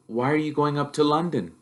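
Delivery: put-on nervous boy asking his uncle; young Harry, unsure and polite; timid boy. Harry asks friendly.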